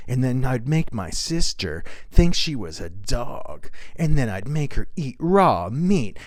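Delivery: ordinary delivery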